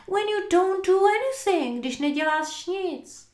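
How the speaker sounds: high-pitched outraged boss; miserly, exasperated squawk; indignant protest. A narrator's reading. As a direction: complain about having to pay the clerk. Scrooge scolds his clerk.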